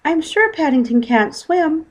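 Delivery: put-on high, slow worried girl; worried young girl; girl's voice. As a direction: voice the daughter, anxious.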